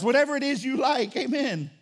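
ordinary talk

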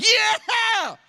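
high, loud celebratory whoop; team-scores cheer. Acted. Fan celebrating a touchdown, loud high cheer. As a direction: burst out yelling like a fan. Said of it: "you go like".

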